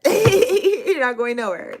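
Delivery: slow, laughing bossy command; mock-stern order. Reader sends tired client to bed, laughing mock-stern order. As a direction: scold her playfully, laughing.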